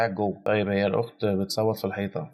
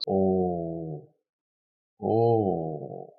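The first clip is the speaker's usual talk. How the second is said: long stunned oh; 2 semitones lower, much slower than usual. Real, not acted. Stunned reaction to trailer shot.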